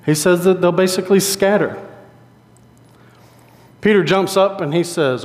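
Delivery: ordinary talk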